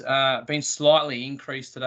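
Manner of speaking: normal talking voice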